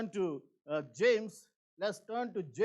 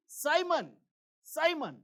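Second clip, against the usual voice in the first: urgent calling; 6 semitones higher, 3 dB louder than usual. Retold or imitated. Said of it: "Jesus is calling Simon Peter twice".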